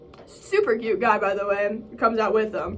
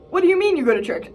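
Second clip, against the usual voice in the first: lightly playacted puzzled investigator, pitched up; investigator style; 6 semitones higher, 4 dB louder, faster than usual. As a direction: question it like a baffled newcomer. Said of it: "Like, investigator style".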